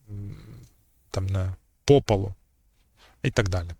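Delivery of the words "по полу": ПО stressed in «по полу»; stress on the preposition. Shown as a demonstration. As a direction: say «по полу» as one word, hitting ПО. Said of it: "І таких, е, прикладів може бути багато".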